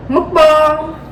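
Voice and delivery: loud, high show announcement; excited announcement. Pretend. Announcing the segment.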